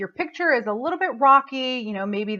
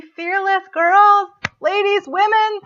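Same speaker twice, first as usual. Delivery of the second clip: high, loud, slow rousing call; rallying cry; 11 semitones higher, 8 dB louder, slower than usual. Real, not acted. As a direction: lift the room as you name them. Rallying the audience to be fearless.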